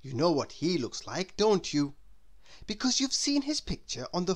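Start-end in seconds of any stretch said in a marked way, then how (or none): none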